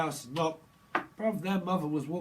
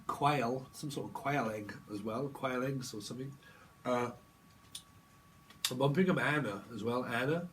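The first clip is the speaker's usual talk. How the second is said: ordinary talk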